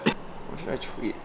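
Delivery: soft slow whisper. Real, not acted. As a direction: whisper it slyly. Whispered aside.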